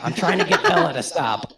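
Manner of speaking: high, loud repeated complaint. Real, not acted. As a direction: insist loudly, at normal speed. Repeats protest over laughter.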